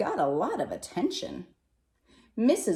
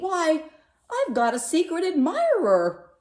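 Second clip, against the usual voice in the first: high, loud delighted burst; joyful exclamation; 11 semitones higher, 8 dB louder than usual. A narrator's reading. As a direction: cry it out, thrilled at the discovery.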